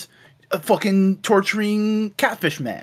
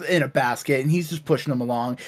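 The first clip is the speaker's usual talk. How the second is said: ordinary talk, nothing marked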